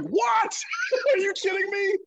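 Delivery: high, shouted what breaking into a laugh; stunned disbelief. Retold or imitated. Player hearing he's called up to Pittsburgh, shouting in delighted shock. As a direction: yelp it out in happy shock, laughing. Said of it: "And I'm like".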